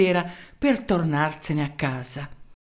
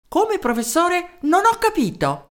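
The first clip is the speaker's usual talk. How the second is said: a higher, eager mayor's voice; 11 semitones higher than usual. Acted. Mayor introducing himself to the professor, eager. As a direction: introduce yourself keenly down the phone.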